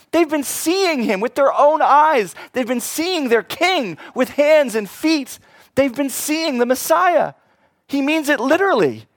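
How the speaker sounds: high, loud impassioned preaching; full-force preaching. Real, not acted. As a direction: preach it with fire.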